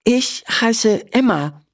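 put-on high, slow girl's voice; a shy little girl giving her name; girl introducing herself; Emma. A narrator's reading.

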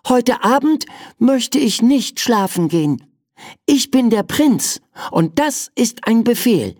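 put-on high, bossy child prince voice; a spoiled little prince giving orders; young prince. The prince's opening demand.

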